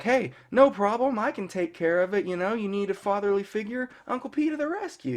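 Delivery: cheesy high-pitched sitcom uncle; Uncle Pietro. Retold or imitated. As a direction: pitch it up into hammed-up, cheery nineties TV dialogue. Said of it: "just real cheesy nineties, two thousands dialogue".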